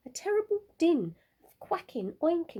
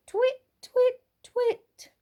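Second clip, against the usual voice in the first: high, louder owl calls; owl twit calls; 7 dB louder, much slower than usual. A narrator's reading. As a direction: chirp each twit like an owl. Olive hooting for a mate.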